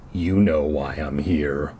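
deep, slow villain snarl; low and slow threat. A narrator's reading. Caped man snarls at the girl. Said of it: "he snarled".

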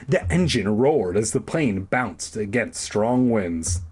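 normal talking voice

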